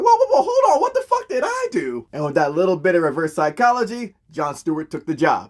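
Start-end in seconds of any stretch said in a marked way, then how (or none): 0.0-2.0 much higher, startled whoa whoa; alarmed protest. Retold or imitated. John reacts to Katma insulting Earthmen, startled and high. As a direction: backpedal in shock, voice jumping way up.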